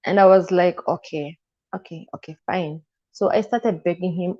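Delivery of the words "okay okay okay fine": a tired, softer okay, just letting it go; resigned giving in. Retold or imitated. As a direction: mutter it much softer, giving up. Giving in to brother's advice.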